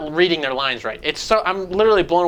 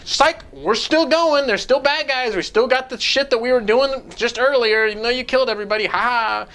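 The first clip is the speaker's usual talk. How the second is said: a high voice poking fun at the twist; mocking game characters; 6 semitones higher than usual. Mocking game's fake ending.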